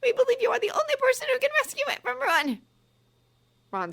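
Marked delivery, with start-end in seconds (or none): 0.0-2.6 high fast pleading; desperate pleading. Acted. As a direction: beg quickly, much higher. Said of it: "she cried".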